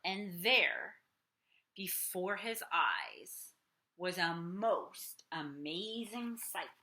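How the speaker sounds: put-on lively read-aloud for kids; storybook reading. A narrator's reading. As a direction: read it like bedtime story time. Reading picture book aloud.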